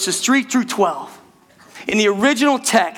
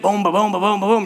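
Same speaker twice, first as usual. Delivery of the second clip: rapid-fire rolling run; rolling excitedly; faster than usual. Retold or imitated. Preacher showing how Peter rolled through verses, rolling excitedly. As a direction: rattle it off in one run. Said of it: "he was rolling".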